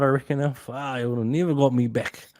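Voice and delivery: ordinary talk, nothing marked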